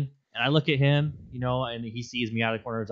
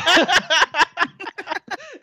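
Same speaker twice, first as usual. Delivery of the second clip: squealing laugh, much higher and louder; high cackling laugh; 8 dB louder than usual. Real, not acted. Teller cracking up at his own story, squealing laugh. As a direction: burst out cackling.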